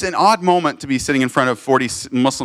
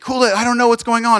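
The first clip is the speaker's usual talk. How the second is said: startled; 7 semitones higher than usual. Retold or imitated. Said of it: "she's like".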